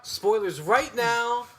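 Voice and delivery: very slow, stretched warning; drawn-out warning. Real, not acted.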